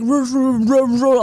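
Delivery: loud garbled mumble imitation; mumbling, mouth full of rocks. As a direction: imitate someone talking through a mouthful of rocks. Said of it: "like, rocks in his mouth".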